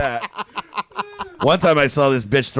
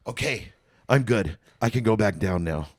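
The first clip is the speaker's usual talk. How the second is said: imitated forced calm; 2 semitones lower, 4 dB quieter than usual. Bartender asking to go back down, imitated calm. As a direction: act suddenly composed, imitating her. Acts her sudden fake composure.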